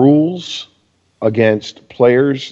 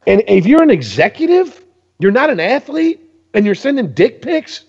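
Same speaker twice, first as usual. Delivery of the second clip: pitched way up, incredulous rant; high, outraged disbelief; 11 semitones higher than usual. Real, not acted. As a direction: rail at them in disbelief. Ranting at executives.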